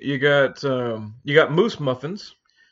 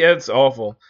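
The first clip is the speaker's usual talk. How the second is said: normal talking voice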